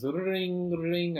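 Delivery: high, loud trilled British phone ring; imitated phone ring. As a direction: trill it like an old telephone chime. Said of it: "British sounding phone ring chime".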